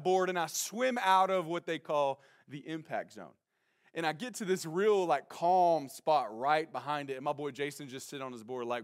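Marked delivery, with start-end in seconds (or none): none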